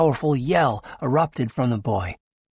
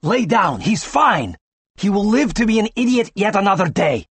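exasperated; 7 semitones higher, 5 dB louder than usual. A narrator's reading. Doctor scolds struggling patient.

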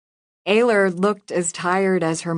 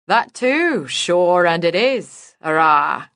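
mock Irish accent; 5 dB louder, slower than usual. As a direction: lay the Irish accent on thick and slow. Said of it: "Judy said in a mock Irish accent".

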